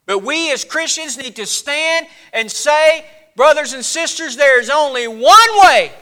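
high, ringing pulpit declaration; forceful sermon voice; impassioned preaching. Real, not acted. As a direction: declare it to the congregation, building to a loud peak.

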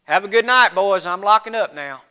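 low-key, uninterested store clerk; dismissive shopkeeper. Acted. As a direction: politely send them off, unconvinced. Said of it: "he probably just went".

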